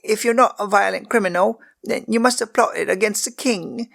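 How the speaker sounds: put-on high girl's voice, curious and bold; a ten-year-old maid pressing the prisoner; young girl Megan. A narrator's reading. Servant girl questions the prisoner, high and eager.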